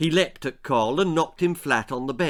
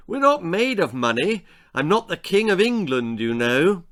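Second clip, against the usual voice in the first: put-on a touch of a teasing old uncle, louder; lightly voiced uncle; 6 semitones higher, 5 dB louder than usual. Uncle Herbert teases the boys about money, lightly louder.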